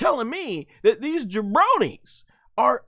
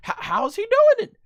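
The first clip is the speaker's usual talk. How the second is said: high, louder baffled cry; baffled outburst; 12 semitones higher, 5 dB louder than usual. Real, not acted. Fan watching the fight, crying out high and baffled. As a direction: demand an answer from the screen. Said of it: "I'm like".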